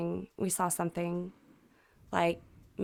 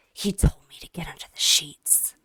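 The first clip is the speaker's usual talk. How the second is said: imitating a monotone, lower and partly whispered; 4 semitones lower, 4 dB louder than usual. Neighbor says what he was told, monotone. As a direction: retell it in a low, toneless voice. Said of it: "his voice was all of a sudden monotone".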